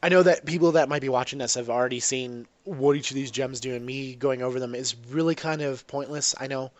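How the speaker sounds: ordinary talk, nothing marked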